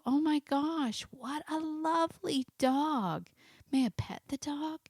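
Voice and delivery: high, slow gushing voice; a stranger cooing at a well-behaved dog; admiring stranger. Pretend. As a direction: gush over the lovely dog.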